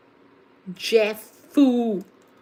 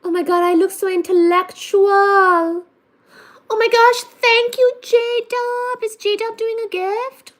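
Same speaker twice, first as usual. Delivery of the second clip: high, loud, thrilled; excited; 6 semitones higher, 5 dB louder than usual. Real, not acted. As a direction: gush over the gift. Thrilled by gifts, thanking.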